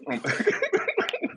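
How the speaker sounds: high, wheezy fit of laughter; real breathless laugh; uncontrollable laughing. Real, not acted. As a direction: crack up helplessly mid sign-off. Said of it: "I'm laughing so much".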